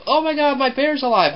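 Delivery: put-on high, excited little-boy gasp of wonder; amazed kid. Boy discovering his teddy bear is alive, high excited wonder. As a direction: squeal it like a kid seeing magic. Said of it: "very real moment where the kid's like".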